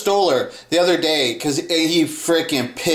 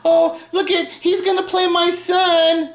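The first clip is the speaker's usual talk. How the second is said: much higher, mocking proud-mother voice; 12 semitones higher than usual. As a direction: show off your child in a high, mocking tone. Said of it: "She always bring in [name]".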